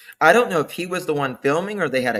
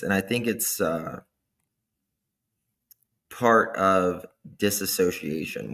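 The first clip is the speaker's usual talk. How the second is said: ordinary talk, nothing marked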